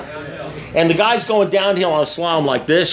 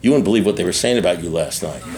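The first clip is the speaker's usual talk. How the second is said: low confiding gossip, dropped well below usual pitch; gossiping false friend; 8 semitones lower than usual. Acted. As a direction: lean in low with juicy gossip. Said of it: "Just go up to him and say".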